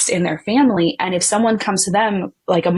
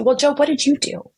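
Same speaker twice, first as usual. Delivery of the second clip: higher, pointed accusing question; accusing friend; 7 semitones higher than usual. Retold or imitated. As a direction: put the blame on Job. Friends turning on Job.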